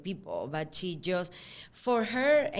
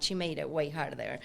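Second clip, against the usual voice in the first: ordinary talk, nothing marked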